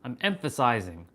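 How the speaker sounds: ordinary talk, nothing marked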